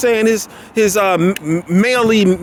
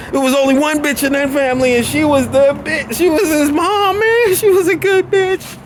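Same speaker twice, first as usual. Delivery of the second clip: theatrical fake crying; mock crying; 8 semitones higher than usual. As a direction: pretend to weep for his mom. Mock grieving over Buddy's mom.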